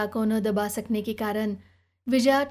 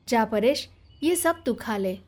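plain reading voice

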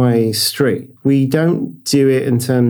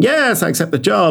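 eager reply; 7 semitones higher than usual. Retold or imitated. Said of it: "immediately replied saying".

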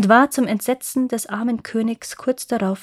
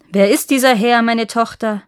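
booming demand; 7 dB louder than usual. A narrator's reading. King bursts in and demands to know who the man is, loudly. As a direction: call out across the hall, startled father. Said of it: "schallte es da durch den Raum".

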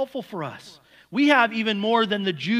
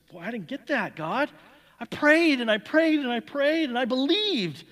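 confused complaint; 8 semitones higher, 4 dB louder than usual. Retold or imitated. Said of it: "confused look on his face".

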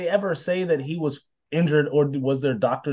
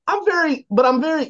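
high-pitched outburst; 12 semitones higher, 5 dB louder than usual. Real, not acted.

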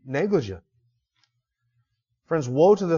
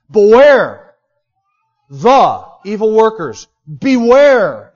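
"Beware" shouted, slow and much louder; exclamation-point warning; 4 semitones higher, 11 dB louder, much slower than usual. Shown as a demonstration. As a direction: bark out each "Beware" like a command. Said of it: "written with an exclamation point".

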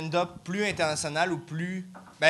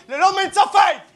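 high, loud excited shout; yelling it out with glee; 14 semitones higher, 9 dB louder than usual. Real, not acted. Laughing high-pitched outburst.

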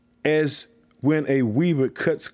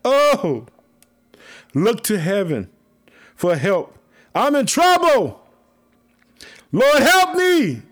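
high, loud, slow wailing plea; desperate drawn-out cry to heaven; anguished cry for help; 9 semitones higher, 6 dB louder, slower than usual. Acted. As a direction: wail it out to the Lord in distress. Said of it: "I moaned like the morning dove".

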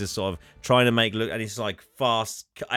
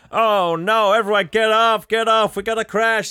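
much higher, louder hammed-up panic; panicked tram driver; 11 semitones higher, 6 dB louder than usual. Tram driver yelling to passengers before the crash, high mock panic. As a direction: shout a comic alarm at the passengers. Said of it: "he's like".

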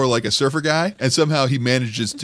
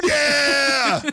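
much higher yelled Texas-style yeah; bad Texas yeah. Joining the bad Texas accent bit, high yell. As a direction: belt out a mock Texas yeah. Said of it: "the bad Texas accent".

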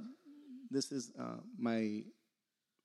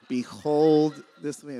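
mock grand proclamation; 9 dB louder, much slower than usual. Mock grand pronouncement in prayer.

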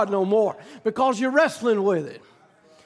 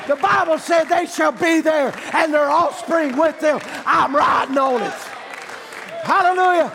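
full-force preaching; 3 semitones higher than usual. Acted. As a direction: whip the congregation up with hallelujahs. Preaching climax, hallelujahs.

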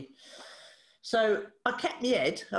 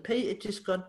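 normal talking voice

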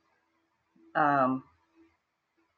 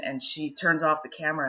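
ordinary delivery

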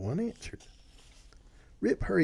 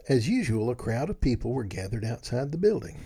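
ordinary delivery, nothing marked

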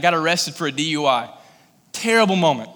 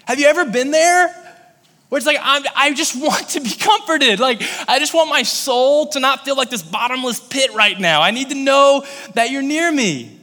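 impassioned preaching; 8 semitones higher than usual. Acted. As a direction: preach it to the room with full force. Preaching at full force.

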